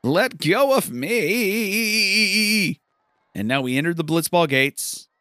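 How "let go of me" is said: high, squeaky, hammed-up pleading through a crowd; jostled star begging the crowd in a squeaky voice; panicked squeaky plea. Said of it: "and Titus is like".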